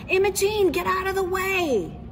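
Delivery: high, loud call across the yard; father yelling at his daughter to move. Acted. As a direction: shout her name to get her off the porch.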